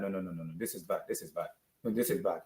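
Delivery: low, soft, beaten-down groan of disbelief; despairing mutter. Real, not acted. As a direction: mumble it to yourself, crushed. Despairing fan.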